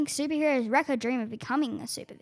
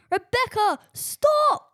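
high, loud desperate yell; dad yelling; 9 semitones higher, 6 dB louder, much slower than usual. Acted. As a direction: yell stop like a frantic father. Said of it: "Her dad yells".

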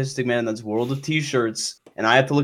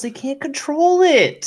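high-pitched mocking valley-girl voice, much higher; mock girly voice; 4 dB louder, slower than usual. Mocking a pedophile apologist in a high girly voice. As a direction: put on a high airheaded girl voice to mock the take. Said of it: "a little more vocal fry".

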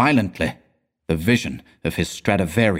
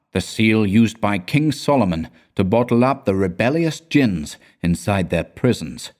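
usual speaking voice

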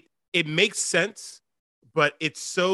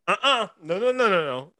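emphatic refusal; 6 semitones higher, 3 dB louder than usual. Retold or imitated. Refusing the role, acted.

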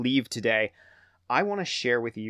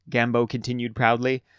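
plain reading voice

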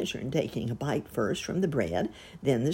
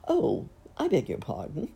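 ordinary delivery

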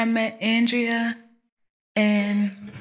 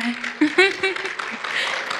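shy giggle; 9 semitones higher than usual. Real, not acted. Shy laugh after baby news.

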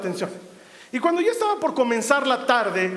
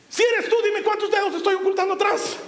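mock demanding; 7 semitones higher, faster than usual. Mocking what Peter could ask.

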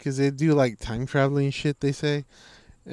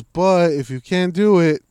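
louder, slow, emphatic; loud slow advice; 5 semitones higher, 7 dB louder, slower than usual. Retold or imitated. Fighter telling viewers to walk away, loud and slow. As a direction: lay it down slowly and loud. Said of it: "He goes".

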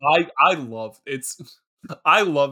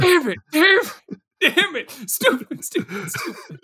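frustrated self-scolding; 11 semitones higher than usual. Real, not acted. Co-host flubs his bit and scolds himself, high frustrated cry. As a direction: scold yourself sharply for flubbing the line.

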